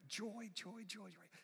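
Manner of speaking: high, hushed imitation of holding back tears; mock self-coaxing. Preacher imitating himself fighting tears onstage, high and hushed. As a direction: squeak it out under your breath.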